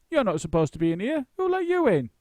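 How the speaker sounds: shouting stagehand. Acted. Stagehand shouting after bear.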